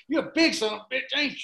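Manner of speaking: high mock good-old-boy voice; roofer impression. Recalling the roofer's unforgettable line, high imitated voice. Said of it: "that quote, I will never forget it".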